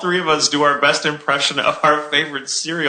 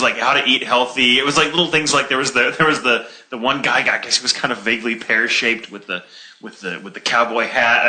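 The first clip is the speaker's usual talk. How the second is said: ordinary talk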